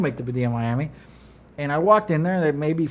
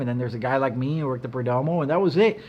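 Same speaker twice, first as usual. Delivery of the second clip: ordinary talk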